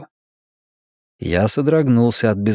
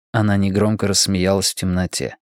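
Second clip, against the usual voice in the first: ordinary delivery, nothing marked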